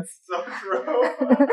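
laughing through the words. Real, not acted. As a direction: laugh as you agree. Host reacts with laughter.

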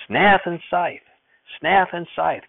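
grandfather shouting. Acted. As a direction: bark it out like an impatient grandpa. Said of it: "Grandfather shouted".

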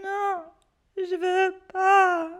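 put-on child whimpering no; whiny refusal. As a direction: drag it out like a frightened child. Scared boy refusing.

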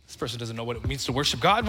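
low, muttered critical aside; quietly mocking a critic's scorn; judgmental churchgoer. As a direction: sneer at the worshipper under your breath. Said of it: "and they're like".

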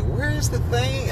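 high, slow mocking whine; mocking wife voice. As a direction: mimic her asking, exaggerated. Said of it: "You be like".

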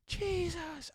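high piercing scream of the name. Retold or imitated. A man in a vision screaming to Jesus, high. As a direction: scream it out with everything. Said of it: "I was screaming".

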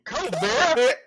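high sing-song beer vendor cry; stadium vendor hawking. Shown as a demonstration. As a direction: call it out over the crowd. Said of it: "I said the same thing every time the same way and see if I can recreate it".

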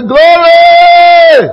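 high shouted glory in jest; mock joyful shout. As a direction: shout it like an overjoyed disciple. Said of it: "You lot thought Peter would say".

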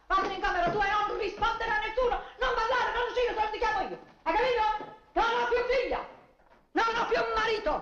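high furious mother's tirade; furious scolding. Acted. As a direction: rage at the daughter. Mother disowning daughter in fury.

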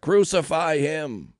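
slow, loud, high mob cry; shouted back. A narrator's reading. Crowd demanding execution from Pilate, shouting back. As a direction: shout it out slowly, hammering each word. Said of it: "They shouted back".

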